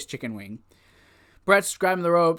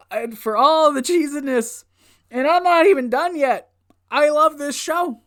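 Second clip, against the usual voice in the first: high-pitched, giddy raving; thrilled, raised-pitch fan rave; gushing enthusiasm; 8 semitones higher, 3 dB louder than usual. Real, not acted. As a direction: gush it high with sincere delight. Raving about loving the show.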